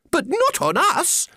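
very high alarmed squeal; crying-out little oysters. Acted. Oysters realise they are the meal, crying high. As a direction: squeak the protest in a tiny voice. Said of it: "the oysters cried".

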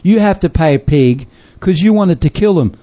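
usual speaking voice

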